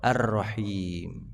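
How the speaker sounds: Ar-Rahim stretched very slow, each syllable held; drawn-out name. Shown as a demonstration. Preacher answering his own question to the men, Ar-Rahim drawn out. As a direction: lean on Ar-Rahim and hold it long. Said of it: "Nama Allah itu, Pak?".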